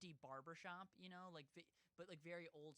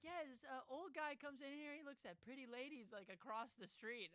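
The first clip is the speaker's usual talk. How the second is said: higher-pitched, casual retelling voice; imitated barber woman; 7 semitones higher than usual. Korean barber woman explains the binoculars, lightly imitated nonchalance. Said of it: "she's just like".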